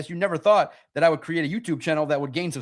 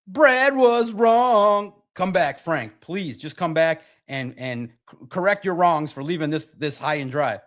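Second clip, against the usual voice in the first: mock pleading to a departed player; begging; slower than usual. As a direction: beg him to come home. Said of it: "call Frank Ragnow and beg".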